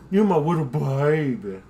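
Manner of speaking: slow affectionate pet coo. Real, not acted. Cooing to the dog.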